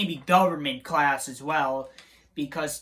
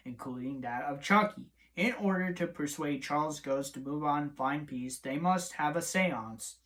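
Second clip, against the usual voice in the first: softer, steady read-through of the recap; quiet recap narration; plot summary read; 3 semitones lower, 5 dB quieter than usual. Acted. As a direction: read the synopsis off the page.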